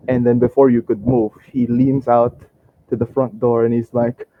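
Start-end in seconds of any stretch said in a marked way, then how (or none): none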